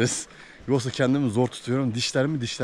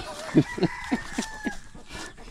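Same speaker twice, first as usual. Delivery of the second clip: squeaky laugh; 4 dB quieter than usual. Real, not acted. Laughing at goat.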